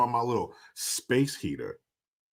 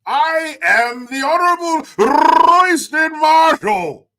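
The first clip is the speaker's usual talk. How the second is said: booming, drawn-out, high British pomp; stiff-upper-lip British; 14 semitones higher, 11 dB louder, slower than usual. Pretend. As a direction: proclaim it like a pompous British dignitary, loud and slow. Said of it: "Like your stiff upper lip British accent".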